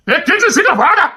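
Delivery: urgent call. Acted. Calling someone over urgently.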